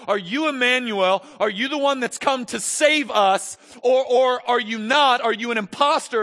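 John the Baptist begging Jesus for an answer; doubting John. Retold or imitated. Preacher voicing John's doubts to Jesus, pleading. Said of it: "he sends his doubts to Jesus".

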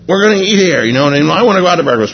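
acted-out spousal squabble; retold bickering. Couple arguing over where to have breakfast, bickering.

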